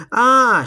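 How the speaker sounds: loud high shriek of ah; boy's loud high shriek. A narrator's reading. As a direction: cry out in shock. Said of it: "shrieked Peter".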